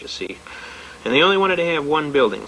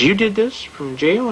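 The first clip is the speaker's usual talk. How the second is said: higher, drawn-out disbelieving question; slow mock amazement, rising at the end; retold astonishment; 6 semitones higher, slower than usual. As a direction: imitate a judge who can't believe it. Acts out the astonished judge.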